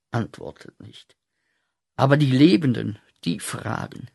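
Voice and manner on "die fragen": whisper. Acted. The living ask at night.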